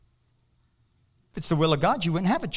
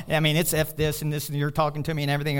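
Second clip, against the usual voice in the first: normal talking voice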